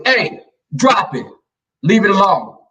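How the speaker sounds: high, forceful order; bad cop command. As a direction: order the pet firmly, high and loud. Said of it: "I had to be the bad cop".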